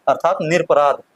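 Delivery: ordinary delivery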